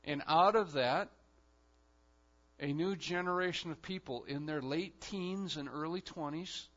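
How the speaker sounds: ordinary talk, nothing marked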